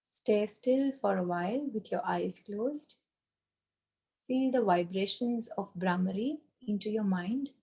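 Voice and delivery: soft, calm meditation guidance; guided meditation. Acted. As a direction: gently guide them back, softly. Leads closing relaxation.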